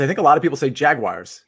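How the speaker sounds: ordinary talk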